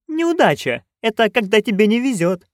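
put-on high old-witch voice; explaining nanny. A narrator's reading. Nanny explaining bad luck to friend, helpfully.

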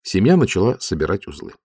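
usual speaking voice

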